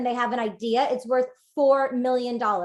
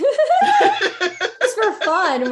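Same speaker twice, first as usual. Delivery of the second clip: loud, high laugh drawn through the words; 10 semitones higher, 6 dB louder, much slower than usual. Real, not acted. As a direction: crack up while getting the words out. Laughs at her own suggestion.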